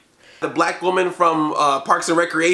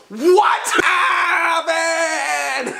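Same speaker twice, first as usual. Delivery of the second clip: shocked yell; 7 semitones higher, much slower than usual. Real, not acted. Reviewer reacting to the cameo with a shocked yell. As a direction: yell it in disbelief.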